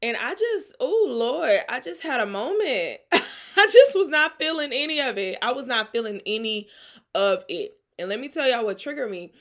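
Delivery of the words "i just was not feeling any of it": a real laugh while admitting she'd had enough. Real, not acted. Telling viewers about her bad week, laughing and loud. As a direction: laugh through the words, higher and louder.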